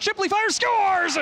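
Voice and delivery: excited goal call. Real, not acted. Goal call in hockey broadcast.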